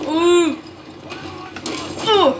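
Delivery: high, loud wince of surprise; a sharp startled ooh. Real, not acted. Reacting to hit in battle.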